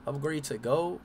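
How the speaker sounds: ordinary talk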